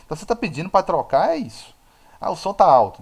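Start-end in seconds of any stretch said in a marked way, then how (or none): 2.2-3.0 raised, higher mock protest; imitated complaint. Teacher quoting a listener bothered by the sound, loud mock complaint. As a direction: throw out the quoted complaint loudly. Quotes the complaint once more.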